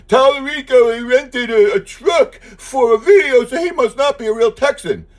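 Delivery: high, loud whiny mockery of his critics; mocking critics. Mocking the critics who say he's not a real Texan. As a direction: sneer it like the people attacking Tallarico. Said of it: "they come out every day and say".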